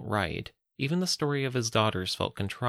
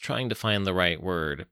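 plain reading voice

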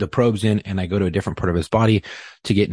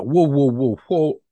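urgent, louder whoa whoa; alarmed whoa; 6 semitones higher, 4 dB louder, slower than usual. Retold or imitated. Officer halting the others on the radio news, urgent. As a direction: call a sudden stop.

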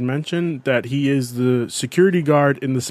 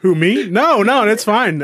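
high, loud protest that it's fine; defensive, overdone reassurance; upset denial; 9 semitones higher, 5 dB louder than usual. Real, not acted. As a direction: insist too much, pitched up and louder. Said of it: "he's so upset".

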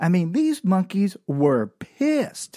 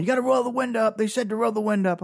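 panicked dad; 6 semitones higher, much faster than usual. Retold or imitated. Dad freaking out.

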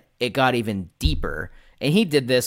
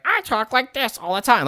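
high, strained creature voice; Stitch-like gremlin; 4 dB louder than usual. Retold or imitated. Player imitating an abused child character's messed-up voice, high gremlin. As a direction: talk like a cartoon gremlin, pitched way up. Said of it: "Stitch from Lilo and Stitch".

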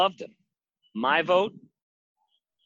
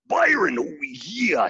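imitating Macho Man; 3 semitones higher, slower than usual. Byron voices Macho Man in kitchen.

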